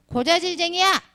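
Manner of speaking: high taunting shout of a name-caller; mock jeer at the teacher; kids taunting. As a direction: tease like a playground kid. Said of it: "이렇게 말하잖아".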